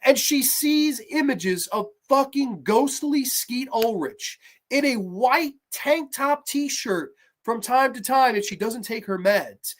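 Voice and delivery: normal talking voice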